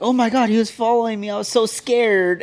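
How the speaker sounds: hammed-up damsel faking fear; mock scared victim. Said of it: "one of those people that fake it".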